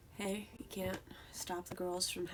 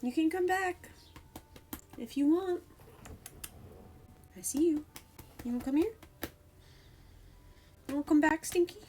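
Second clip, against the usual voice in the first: high, louder coaxing call to a cat; calling the cat back in a high, louder coax; 8 semitones higher, 5 dB louder than usual. Real, not acted. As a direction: lure her back with a lifted voice.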